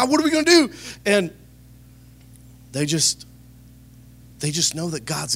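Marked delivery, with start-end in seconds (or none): 0.0-0.7 high mock panic, cry of alarm; freaking out. Freaking out while older Christians stay calm, a hammed-up panicked cry. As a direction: act like you're panicking about the problem. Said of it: "I'll be freaking out".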